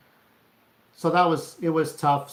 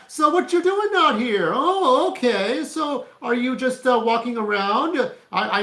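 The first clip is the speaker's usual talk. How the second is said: much higher, louder mocking cop voice; 8 semitones higher, 7 dB louder than usual. Cop questioning a passerby at night, hammed-up high mock voice. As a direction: play a smug suspicious cop shining a light. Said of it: "It's like a cop saying".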